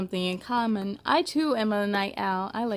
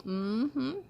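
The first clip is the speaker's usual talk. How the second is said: ordinary delivery, nothing marked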